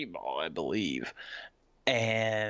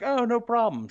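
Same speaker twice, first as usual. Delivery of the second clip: high, louder, oblivious reply; pitched way up, innocently obliging; acted breezy agreement; 13 semitones higher, 6 dB louder than usual. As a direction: imitate an unsuspecting pal happily agreeing. Acts out the unsuspecting friend.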